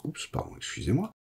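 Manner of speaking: usual speaking voice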